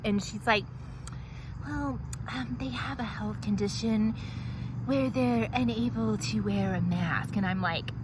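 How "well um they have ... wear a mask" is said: receptionist. Acted. The receptionist's answer, in another voice.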